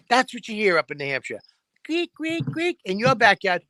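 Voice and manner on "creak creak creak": much higher creaking chirps; cricket noise. Retold or imitated. Imitating a cricket in the backyard, high chirps. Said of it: "one fucking cricket at night".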